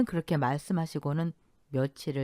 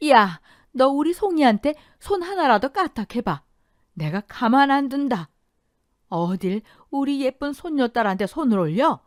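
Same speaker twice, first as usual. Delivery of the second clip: fierce warning; 7 semitones higher, 6 dB louder than usual. A narrator's reading. Grandmother shields granddaughter.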